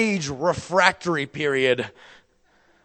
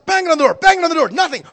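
much higher and louder, frantic; 7 dB louder, much faster than usual. Retold or imitated. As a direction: push it to panic. Pounding on door.

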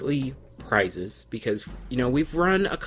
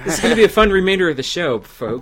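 tipsy; 5 semitones higher, 9 dB louder, faster than usual. Real, not acted. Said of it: "I'm so buzzed right now".

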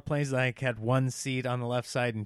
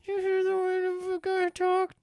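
very high, strained talk through clenched teeth; squeaky clenched-teeth aside; through her teeth. Retold or imitated. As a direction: imitate urgent covert words, teeth shut. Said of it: "talking through her teeth".

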